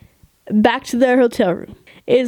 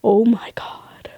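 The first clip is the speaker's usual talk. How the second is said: slow starstruck whisper; awed whisper. Retold or imitated. Seeing her dad's favorite band, slow awed whisper. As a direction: breathe it out in awe. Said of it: "I'm like".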